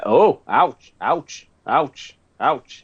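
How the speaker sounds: lightly Welsh, pained ouches; imitating a Welsh friend's ouch; Welsh accent. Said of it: "in a Welsh accent constantly".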